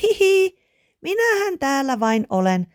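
very high, giggly squeal; high-pitched child's shriek; little girl shrieking. A narrator's reading. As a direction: squeal it down like a delighted small child. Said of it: "hän kirkui".

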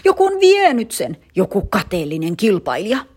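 high, loud accusing screech that eases off; boy screeching that a rival stole the cap. Acted. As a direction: screech the accusation, then settle. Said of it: "Tarmo rääkyi".

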